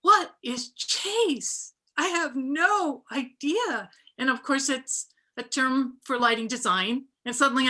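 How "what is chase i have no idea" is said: high, slow, puzzled self-quote; wide-eyed confusion, drawn out; baffled. Retold or imitated. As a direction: play your past self totally lost at the jargon. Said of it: "And I was like".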